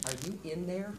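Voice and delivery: soft and tender; gentle, loving. Retold or imitated. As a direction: cradle a face and ask softly. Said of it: "lovingly, gently in your hands".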